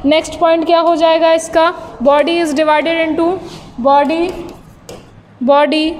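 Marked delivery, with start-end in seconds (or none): 2.0-5.9 word-by-word note dictation; slow dictation. Real, not acted. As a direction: dictate slowly, pausing between phrases. Dictating notes to students. (धीरे-धीरे notes लिखवाते हुए)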